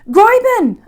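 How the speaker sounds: high, loud call across the road; shouting a name to someone far off. A narrator's reading. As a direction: call her name from a distance. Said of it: "I called out to her".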